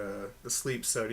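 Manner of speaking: usual speaking voice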